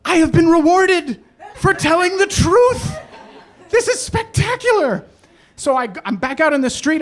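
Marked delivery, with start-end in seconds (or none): 0.1-5.0 high, loud, elated proclamation; mock triumph. Driver amazed at being rewarded for honesty, triumphantly elated. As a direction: proclaim it grandly, hammed-up wonder.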